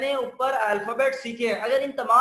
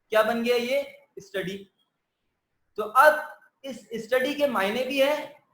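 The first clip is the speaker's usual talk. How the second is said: usual speaking voice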